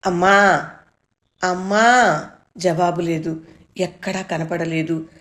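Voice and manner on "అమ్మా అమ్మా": loud repeated calls for mother; frantic boy calling his mother. A narrator's reading. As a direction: shout mom's name twice, desperate.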